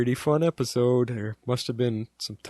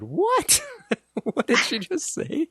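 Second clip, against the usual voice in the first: much higher and louder, stunned, laughing through it; shocked disbelief; 3 dB louder, slower than usual. Retold or imitated. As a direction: burst out in amazed disbelief. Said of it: "we both said".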